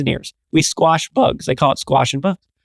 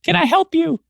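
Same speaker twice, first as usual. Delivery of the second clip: put-on high, thin voice of a nervous young cashier; little guy at the register greets the customer, high and timid. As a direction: squeak out a polite counter greeting. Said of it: "guy behind the register is like".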